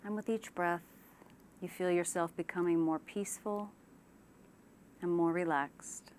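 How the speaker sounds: unhurried relaxation-exercise delivery; guided meditation. Shown as a demonstration. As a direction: ease them gently into calm, leaving space after each phrase. Leads a guided imagery relaxation.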